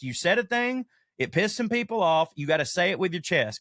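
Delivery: ordinary talk, nothing marked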